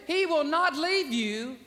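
normal talking voice

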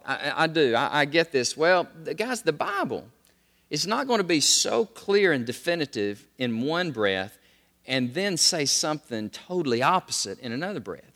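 normal talking voice

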